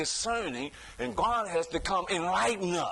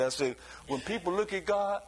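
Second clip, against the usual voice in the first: normal talking voice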